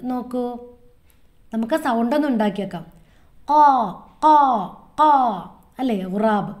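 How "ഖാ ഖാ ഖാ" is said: ഖാ cawed from the back of the throat, uvular kh; crow caw. Shown as a demonstration. Teacher showing children the crow's ഖാ, throaty caw. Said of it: "ഈ അണ്ണാക്കിൽ ഇങ്ങനെ തട്ടിക്കൊണ്ട് വേണം പറയണം ഖാ".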